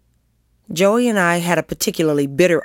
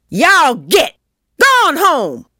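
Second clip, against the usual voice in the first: put-on high, loud old woman's shout; enraged shouting; 11 semitones higher, 6 dB louder, much slower than usual. A narrator's reading. Old woman on a cane chasing kids away, enraged shouting. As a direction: yell to drive the children off. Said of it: "leaning on a rickety cane and shouting".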